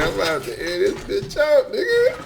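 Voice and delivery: hammed-up quote, last word shrieked high; mock tough maid. Repeating the maid's threat, squealing finish. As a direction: echo the line with a rising squeal on the end.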